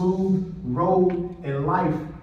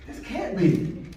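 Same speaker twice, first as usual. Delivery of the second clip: amazed disbelief; 8 semitones higher, slower than usual. Retold or imitated. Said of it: "And I was like".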